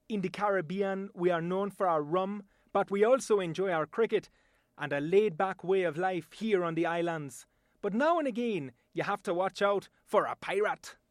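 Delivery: relaxed Caribbean island accent; an islander describing the laid-back life. Shown as a demonstration. A Caribbean local talking rum and cricket, in a Caribbean accent. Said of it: "my voice talent demonstration".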